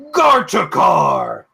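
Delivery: slow, loud booming name call. Acted. Dragon calling out to the guide, slow booming call. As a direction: boom the name across the cavern. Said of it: "the voice calls".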